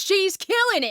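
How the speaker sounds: agitated, pitch jumping up; worked-up outburst. Acted. Performer protests to Keys about the art, worked up. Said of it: "Calm yourself".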